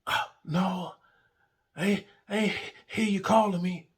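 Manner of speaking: high, hesitant mock excuse; feigned innocence. Partner's excuse acted out.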